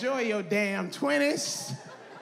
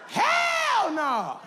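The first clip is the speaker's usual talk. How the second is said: high, shouted refusal; loud comic outburst; emphatic shout; 10 semitones higher, 3 dB louder than usual. Acted. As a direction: holler it, stretching the first word. Emphatic answer to own question.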